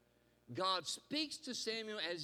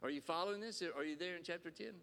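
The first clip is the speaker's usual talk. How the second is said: usual speaking voice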